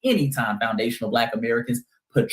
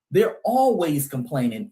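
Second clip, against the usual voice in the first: normal talking voice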